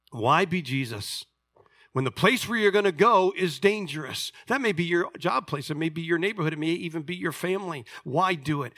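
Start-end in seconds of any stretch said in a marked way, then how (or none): none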